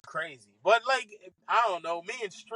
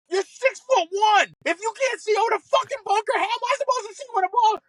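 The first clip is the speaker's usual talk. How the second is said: much higher, louder, exasperated outburst; hammed-up furious rant, racing at the end; snapping back; 13 semitones higher, 4 dB louder than usual. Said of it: "but then I snapped".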